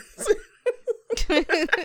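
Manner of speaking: loud high-pitched laugh; big high burst of laughter. Real, not acted. Laughing at hitting the ground.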